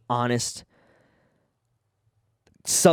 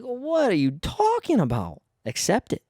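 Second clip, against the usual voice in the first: much higher, incredulous put-on voice; mock indignant quote; 10 semitones higher than usual. Mocking people who justify their candidate, high incredulous voice. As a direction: play the baffled partisan, pitched up. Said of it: "It's like".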